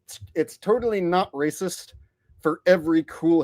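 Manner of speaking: normal talking voice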